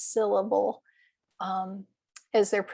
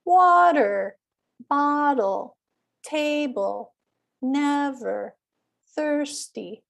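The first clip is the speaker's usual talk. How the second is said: WA-ter, BOT-tle, TA-ble, NEV-er, THIRS-ty, first syllable high and long; exaggerated first-syllable stress; 4 semitones higher, slower than usual. Shown as a demonstration. Teacher modelling column two, water to thirsty with high first syllable. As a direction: stretch the first syllable of water, bottle, table, never, thirsty, drop the second. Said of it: "then say the words in the column".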